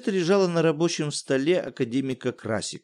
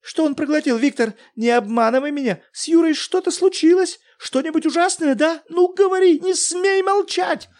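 a very high, loud, frantic demand; истеричный крик; desperate shout; 6 dB louder than usual. Acted. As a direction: scream at him to answer. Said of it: "крикнула Елена Николаевна".

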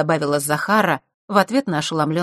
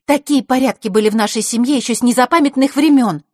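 raised, high defiance; defiant girl; 7 semitones higher, 7 dB louder than usual. Acted. Scene: Zakhara defending family customs, raised and defiant. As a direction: throw it back proudly.

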